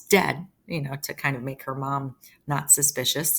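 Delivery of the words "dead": "dead" set apart, louder, with a falling tone; stressed DEAD after pause. Shown as a demonstration. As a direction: drop "dead" in hard after a beat.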